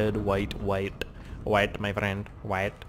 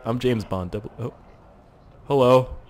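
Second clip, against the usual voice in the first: ordinary talk, nothing marked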